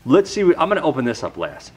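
ordinary talk

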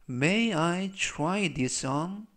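very slow, careful question; slow reading for repetition. Shown as a demonstration. Teacher reading slowly so students repeat. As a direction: stretch each word, rising end. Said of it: "제가 역시 천천히 세 번씩 읽어드리고요".

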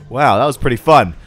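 ordinary talk

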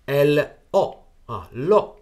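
L O spelled slowly, then lo said clearly; teacher spells out the original article lo. Shown as a demonstration. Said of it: "它原来是".

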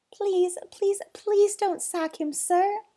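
high, urgent begging; pleading. Acted. Boy begging for the zookeeper's job, pleading. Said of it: "pleaded the boy".

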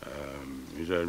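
normal talking voice

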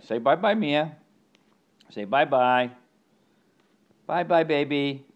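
high, loud sing-song goodbye; high pet-voice farewell. Real, not acted. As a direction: wave off the viewers for the dog. Saying bye to camera.

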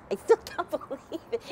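laughing in disbelief. Real, not acted. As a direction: giggle through the words. Joyful disbelief at her healing.

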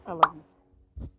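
slow, low soft I love you; tender line. Pretend. Player voicing the confession to his crush, tender. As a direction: say it dreamily.

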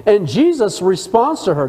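ordinary talk, nothing marked

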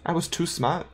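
put-on German accent. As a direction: play the chained undead Gunter with a German lilt. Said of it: "with this accent".

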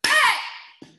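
high, loud power shout; second yell. Shown as a demonstration. Teacher demonstrating the yell that gives power, loud shout. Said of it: "Our second yell".

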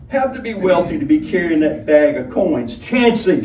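high, brassy, coaxing tavern woman; Honeybell. A narrator's reading. Honeybell pressing the guest for his name. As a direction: push him for his name, loud and high.

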